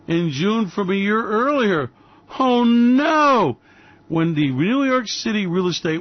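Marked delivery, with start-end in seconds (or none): 2.4-3.6 higher, louder mock dismay; mock alarm. Host reacting to falling prices, mock dismay. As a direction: gasp it out in fake panic.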